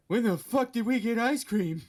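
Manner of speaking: high, loud indignant shout. Retold or imitated. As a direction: yell it out in disbelief. Retold indignant outburst.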